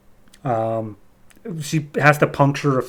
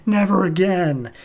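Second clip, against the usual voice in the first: slow, louder and higher mock scream; 7 semitones higher, 3 dB louder, slower than usual. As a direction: drag the words out like a half-played rampage yell. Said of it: "just screaming".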